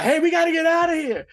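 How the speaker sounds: rally them out, high and loud; urgent call. Retold or imitated. Oz urging escape amid gunfire.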